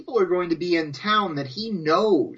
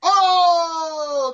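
high mock-outraged howl; 10 semitones higher, 4 dB louder than usual. Real, not acted. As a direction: howl it like a burn landed. Reacting loudly to friend's jokes.

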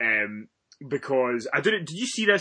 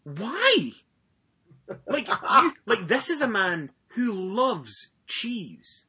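mock outrage; 8 semitones higher, much slower than usual. Retelling his reaction to vegan friend.

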